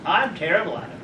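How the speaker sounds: usual speaking voice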